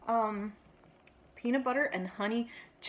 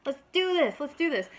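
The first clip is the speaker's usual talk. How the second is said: excited dog voice, a little higher; eager pup begging to start; voicing the dog; 5 semitones higher than usual. Mom's dog voiced as an eager pup, a little higher. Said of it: "He's like".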